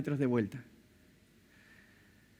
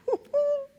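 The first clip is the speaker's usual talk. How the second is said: high squeal of laughter. Real, not acted. Risa aguda tras la repetición.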